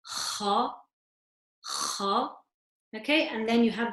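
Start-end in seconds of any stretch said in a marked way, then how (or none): 0.0-2.3 kha with a rasp from the top of the throat; kha said with a scratch at the back of the throat; scratchy kha. Shown as a demonstration. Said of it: "with a scratch to it".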